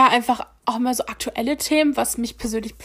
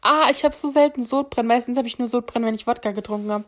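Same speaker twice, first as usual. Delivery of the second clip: usual speaking voice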